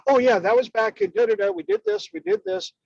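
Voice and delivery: lightly voiced breezy inner voice; a hint of a breezy self; casual recollection. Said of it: "go right to your lesson box and go".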